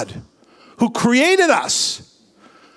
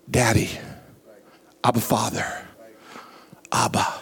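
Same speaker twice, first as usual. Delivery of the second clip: much lower and softer, almost breathed; tender, intimate; 10 semitones lower, 7 dB quieter, faster than usual. Real, not acted. Preacher calls God Father tenderly.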